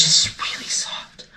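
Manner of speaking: soft unvoiced whisper. Real, not acted. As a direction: breathe it out softly. Whispered aside about fabric.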